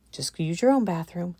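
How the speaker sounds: ordinary delivery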